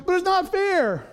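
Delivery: high, loud, whining complaint; mock childish whine; whiny child protest. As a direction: whine it like a kid stuck with a chore. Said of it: "instead of going".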